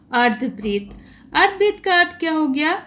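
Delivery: normal talking voice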